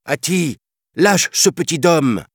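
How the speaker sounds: high, urgent call; panther's cry. A narrator's reading. Panther rescuing the boy from the elephant, crying out. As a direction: cry out a demand to let go. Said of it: "cria la panthère".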